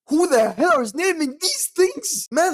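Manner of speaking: high, drawn-out incredulous rant; worked-up exasperation. Real, not acted. Player baffled by the names of Pokemon, worked-up and exasperated. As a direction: demand it in exasperation, pitch jumping up. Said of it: "Let me calm down".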